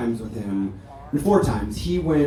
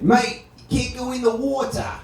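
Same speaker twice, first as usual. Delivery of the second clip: high voice with a hint of an Australian accent; a light Australian lifeguard warning; lightly Australian lifeguard; 11 semitones higher than usual. Australian lifeguard warns him.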